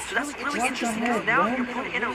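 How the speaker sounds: high-pitched live commentary, building in pitch; excited play-by-play. Real, not acted. Caster announcing game five in the winners' final, excited. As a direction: drive the match call toward the decider.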